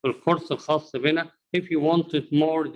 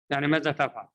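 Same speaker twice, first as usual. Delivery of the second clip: ordinary talk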